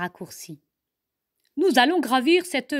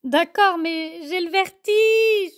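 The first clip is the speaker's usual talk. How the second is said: high, slower, timid cub voice; scared little cousin; 7 semitones higher, 4 dB louder than usual. Acted. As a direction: admit the fear of heights. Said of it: "lui répond sa cousine". (petite ourse craintive, voix aiguë et lente)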